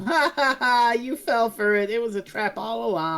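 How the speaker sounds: high, sing-out mock gloating; put-on triumphant ha-ha taunt; mocking kids' taunt. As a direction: jeer like kids who sprang a trap. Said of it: "all the kids laugh at him".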